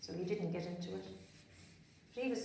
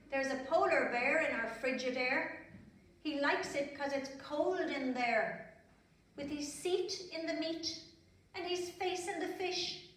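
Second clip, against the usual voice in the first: sing-song rhythmic poem, higher and louder; poem recital; 7 semitones higher, 3 dB louder than usual. Acted. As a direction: bounce the rhyme for children.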